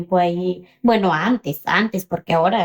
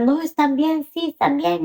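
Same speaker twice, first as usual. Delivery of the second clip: higher-pitched, quick mock reassurance; dismissive reassurance; 7 semitones higher than usual. Coyote to worried mother on the phone, reassuring. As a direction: imitate someone waving a worry away.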